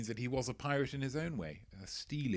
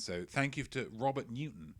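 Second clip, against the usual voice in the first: usual speaking voice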